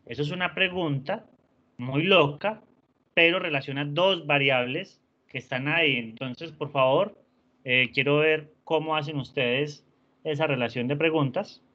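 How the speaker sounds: normal talking voice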